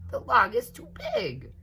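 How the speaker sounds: put-on indignant little child voice; little alligator voice. A narrator's reading. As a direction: squeak it like a tiny gator. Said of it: "said Lightfoot and Quickfoot".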